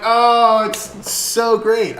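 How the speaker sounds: loud, high, slow gush of delight; thrilled fan. Retold or imitated. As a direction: savour it like a fan in heaven. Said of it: "And I was like".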